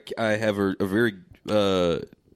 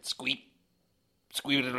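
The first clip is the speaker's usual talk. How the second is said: high squeaky alien squeak; 3 semitones higher, 8 dB quieter than usual. Pretend. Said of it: "I'm trying to speak like an alien".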